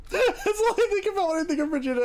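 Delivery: high-pitched giggling speech; laughing. Real, not acted. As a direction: laugh through the words. Laughing while joking.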